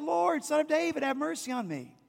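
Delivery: high pleading cry for mercy. Acted. Mother crying out to Jesus for mercy, high pleading cry. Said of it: "The mother cries out".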